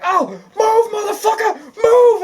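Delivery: hammed-up comic yell at brother; mock yell. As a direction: yell it at your brother, laughing. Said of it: "the one's like".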